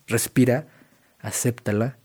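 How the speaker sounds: ordinary talk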